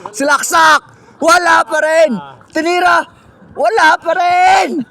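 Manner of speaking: loud, high play-by-play yell; excited commentator shout. Acted. Commentator shouting as John John keeps missing, loud and high. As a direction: call every miss like a packed arena. Commentator yelling at repeated misses.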